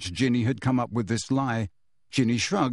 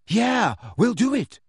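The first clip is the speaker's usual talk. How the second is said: high, eager assent; volunteer without hesitation; keen, emphatic yes; immediate agreement; 9 semitones higher than usual. A narrator's reading. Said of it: "Ginny said immediately".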